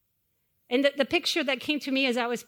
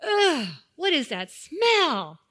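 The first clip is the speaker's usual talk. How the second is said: put-on disgusted kid; 4 semitones higher, 4 dB louder, much slower than usual. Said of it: "Kaylee says".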